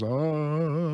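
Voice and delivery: long, drawn-out zombie moan; imitated zombie groan. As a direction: groan like someone playing a zombie. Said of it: "trained to act like zombies".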